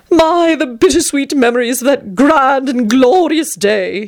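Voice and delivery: wistful dandy sigh. A narrator's reading. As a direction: sigh over the memory. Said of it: "he sighed".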